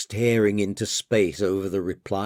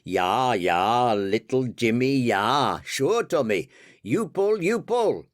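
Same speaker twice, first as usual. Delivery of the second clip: attempted German accent. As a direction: agree keenly in a rough German accent. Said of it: "I can't do the accent, but I'll try anyway".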